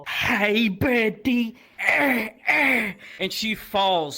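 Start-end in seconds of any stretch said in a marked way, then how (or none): 0.1-1.6 loud, high imitation of his hello; booming mock greeting, drawn out; Ethan's greeting. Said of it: "That's how he talks". 1.8-2.9 two loud, high honking noises; imitated goofy grunts. Imitated noises after the slap.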